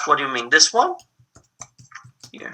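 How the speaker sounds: ordinary talk, nothing marked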